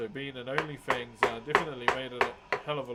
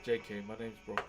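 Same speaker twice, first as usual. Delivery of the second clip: ordinary talk, nothing marked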